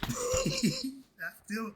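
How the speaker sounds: laughing through the words, slowed; cracking up at himself mid-sentence. Real, not acted. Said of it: "I'm just laughing".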